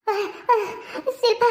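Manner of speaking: choked gasps after strangling; gasping. Acted. Strangled woman calls to Shilpa, gasping. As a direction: catch your breath, then call out weakly.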